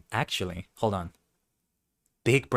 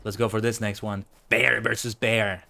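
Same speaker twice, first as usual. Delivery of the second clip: ordinary talk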